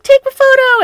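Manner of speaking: high, loud bratty squeal; mock teen girl yelling for a photo; girl demanding a photo.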